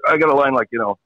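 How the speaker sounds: usual speaking voice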